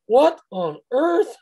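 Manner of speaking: high, slow, drawn-out astonishment; slow, high, amazed exclamation; stunned disbelief. Retold or imitated. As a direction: gasp it out like a bewildered tribune. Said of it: "He's going".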